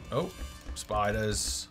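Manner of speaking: ordinary talk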